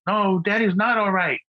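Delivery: imitated kid correcting the grown-ups; young son. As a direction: pipe up like a little boy from the back seat. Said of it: "Nathaniel was in the back seat".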